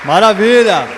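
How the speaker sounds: excited preacher hype. Real, not acted. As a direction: pump the audience up. Hyping up the crowd.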